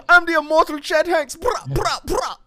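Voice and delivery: very high, loud mock patois boast; hammed-up fake patois, shouted high. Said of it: "He's just like".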